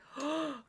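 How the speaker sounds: sharp, high shocked intake of breath; mock gasp. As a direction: gasp as if offended. Mock gasp at own aside.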